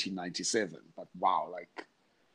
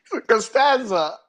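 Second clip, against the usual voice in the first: squeaky, much higher "For Costanza?" through laughter; laughing retelling; 13 semitones higher, 11 dB louder than usual. Retold or imitated. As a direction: retell it barely holding in the laugh. Same quote retold, laughing.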